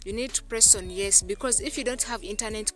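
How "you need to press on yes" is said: normal talking voice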